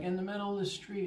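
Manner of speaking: high reply. Retold or imitated. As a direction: toss it back high. Answering the owner.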